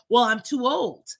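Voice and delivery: usual speaking voice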